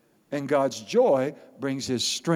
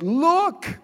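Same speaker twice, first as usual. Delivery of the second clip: high, drawn-out exclamation; emphatic command; 13 semitones higher, 4 dB louder than usual. Acted. Pastor voicing the Lord's reply to Habakkuk, emphatic command. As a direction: call it out as a wonder to behold.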